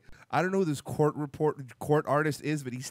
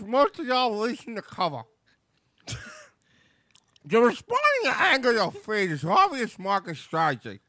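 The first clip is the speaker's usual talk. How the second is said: talking around an ice cube; mouth full; 4 semitones higher than usual. Real, not acted. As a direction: read with ice in your mouth. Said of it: "nearly choking on your".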